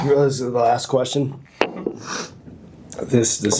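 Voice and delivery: slow, strained through spicy burn; mouth burning from spicy food. Real, not acted. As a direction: speak with the tongue on fire. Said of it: "My tongue is on fire".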